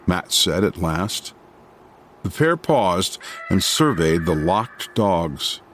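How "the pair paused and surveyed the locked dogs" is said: ordinary delivery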